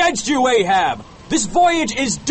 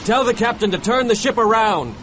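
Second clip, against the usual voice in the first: higher, urgent character voice; worried plea, pitched up; nervous; 8 semitones higher than usual. A narrator's reading. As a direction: urge it anxiously. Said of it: "said Starbuck nervously".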